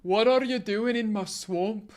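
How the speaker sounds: slow, deepened Shrek accent attempt; slow it down for a bigger Shrek accent. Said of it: "people with deeper voices are better at accents".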